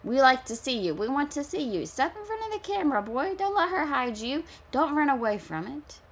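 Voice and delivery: put-on high-pitched plea to a child; mock coaxing. Commenter urging the hidden boy toward the camera, high-pitched coaxing. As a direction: coax in a high, singsong-like lift. Calls to boy in watched video.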